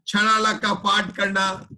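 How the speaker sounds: ordinary delivery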